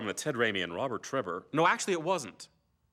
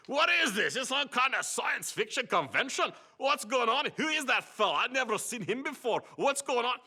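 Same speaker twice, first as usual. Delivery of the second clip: high-pitched, hammed-up Scottish accent; mock Scottish accent; 12 semitones higher than usual. Two men in fake beards heckling, mock Scottish accent. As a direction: play an indignant Scot, voice pitched way up. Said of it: "rather a poor Scottish accent".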